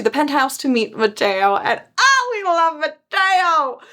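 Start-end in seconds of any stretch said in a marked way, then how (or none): 2.0-3.8 high, drawn-out fan gush; gushing adoration. Real, not acted. Fan cheering the etiquette teacher's arrival, gushing. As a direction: swoon over a favorite, stretching the words. Gushing over favorite character.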